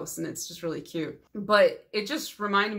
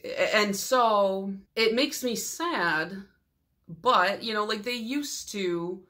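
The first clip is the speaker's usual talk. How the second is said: ordinary delivery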